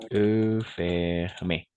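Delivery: E stretched out slowly, closed lips; slow e fermé demo. Shown as a demonstration. (E fermé lenta y clara)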